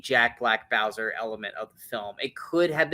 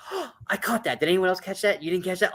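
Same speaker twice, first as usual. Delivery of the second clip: gasping, higher-pitched gloating fan; imitated excited fan; 5 semitones higher than usual. Fan catching Easter eggs others missed, mock excitement. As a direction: act out a fan thrilled at spotting it. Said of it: "It's more like".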